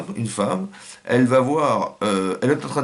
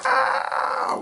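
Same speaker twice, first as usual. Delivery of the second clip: piercing high scream of terror; falling man's scream. Shown as a demonstration. Showing a man falling off a cliff, high terrified scream. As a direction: scream it out as if plunging. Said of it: "Pourquoi il crie?".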